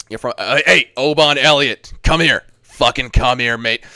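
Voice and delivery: put-on fighter yelling a challenge; hammed-up fight impression. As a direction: play-act calling out an opponent, loudly. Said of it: "I'll give you an impression".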